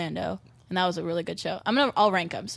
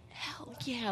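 whispered hell yeah; 3 semitones higher, 13 dB quieter than usual. Retold or imitated. Crowd quote, voiceless.

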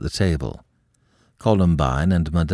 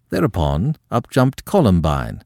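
plain reading voice

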